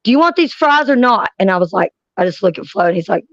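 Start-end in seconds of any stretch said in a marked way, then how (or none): 0.0-1.3 raised voice, higher pitch; impatient offer. Retold or imitated. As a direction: call up from downstairs, testy. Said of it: "I hear".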